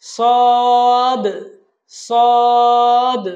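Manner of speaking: সদ loud and long, bounced final d; pushed final sound. Shown as a demonstration. Teacher models the letter, সদ long with pushed ending. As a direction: hold সদ level, then knock out the d. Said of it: "একটু ধাক্কা দিয়ে আমরা উচ্চারণ করব".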